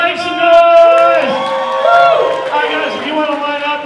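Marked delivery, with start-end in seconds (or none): 0.0-2.5 loud, slow crowd-hype shout. Real, not acted. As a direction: stretch it out to pump up the crowd. Host hyping the crowd.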